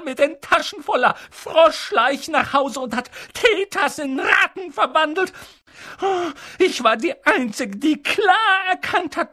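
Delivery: high-pitched, scornful tirade; Tante Petunia shrieking. A narrator's reading. Aunt venting about her witch sister, shrill shrieking rant. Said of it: "schrie Tante Petunia plötzlich auf".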